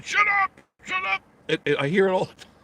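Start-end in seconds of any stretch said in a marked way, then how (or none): 0.0-1.2 high mock yell of shut up; husband snapping back. Husband yelling back at his nagging wife, mock shout. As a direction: fire it back at her, hammed-up. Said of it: "And he's going".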